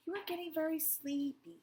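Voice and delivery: high lulling hypnotist voice; sing-song hypnotic suggestion. Acted. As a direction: lull her toward sleep.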